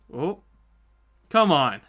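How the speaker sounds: normal talking voice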